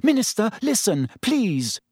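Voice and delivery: high-pitched pleading; imploring. A narrator's reading. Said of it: "gazing imploringly into Fudge's".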